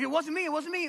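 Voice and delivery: high, loud mock denial; crowd denying. The crowd denies touching Jesus, mock innocent.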